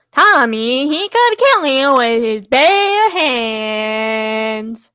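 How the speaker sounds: slow, drawn-out fake accent; hammed-up Italian-or-Texan accent; unplaceable accent. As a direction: stretch the vowels in an unplaceable accent. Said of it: "cannot place geographically where that accent is".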